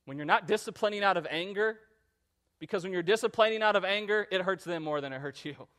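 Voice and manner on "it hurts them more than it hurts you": usual speaking voice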